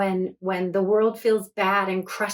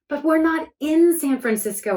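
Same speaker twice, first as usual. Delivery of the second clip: higher, louder shout; girl shouting inside a whirlwind of light; 7 semitones higher, 4 dB louder than usual. A narrator's reading. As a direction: shout over the roaring wind. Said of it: "I shouted".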